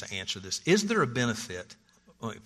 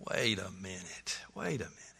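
whispered, much softer double take; hushed doubt; 3 semitones lower, 8 dB quieter than usual. Retold or imitated. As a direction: breathe it like a private second thought.

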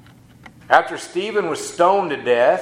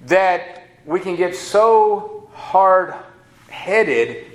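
drawn-out emphasis; much slower than usual. Acted. Preacher stretches key phrase.

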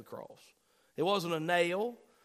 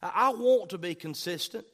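ordinary talk, nothing marked

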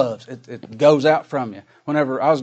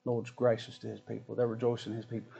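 soft, low, breath-led "oh"; hushed concession; 3 semitones lower, 13 dB quieter than usual. Acted. Critic conceding to the one who bragged on God, hushed and low. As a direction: drop to a humbled near-whisper. Acts out the humbled critic's reply.

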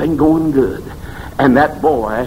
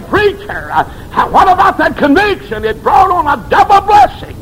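mock heckler shout. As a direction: shout it up high like a scoffer in the pews. Preacher voicing a heckler, shouting.

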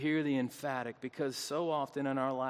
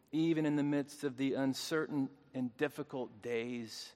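usual speaking voice

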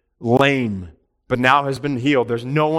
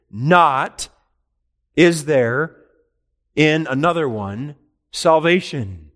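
"not" punched louder, set apart by pauses; stress on not; 3 semitones higher, 5 dB louder, slower than usual. Shown as a demonstration. Preacher showing Greek word order, "not" stressed loud. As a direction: hit "not", then pause before "is there". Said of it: "The emphasis is all on that word "not".

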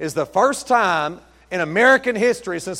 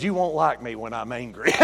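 low, soft warning; restrained imitated menace; David Banner; 5 semitones lower, 5 dB quieter than usual. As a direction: quote the TV threat, hushed and low. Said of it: "He said".

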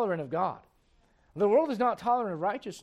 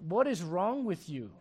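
calm reply; 3 semitones lower, 8 dB quieter than usual. Retold or imitated. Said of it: "And I said".

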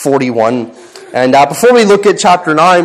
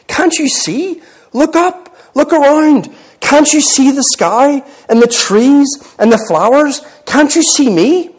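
high, rising questions as Lucy; lightly acted, high-pitched appeal; urgent pleading; 8 semitones higher than usual. A narrator's reading. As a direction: plead with them to see, pitch lifted. Lucy pleads with dwarf to see.

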